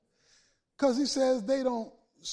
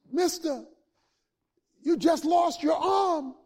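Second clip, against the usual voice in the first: shouting policeman; 6 semitones higher, 4 dB louder than usual. Acted. Policeman calling out.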